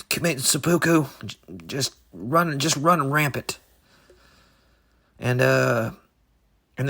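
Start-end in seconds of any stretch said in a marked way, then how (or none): none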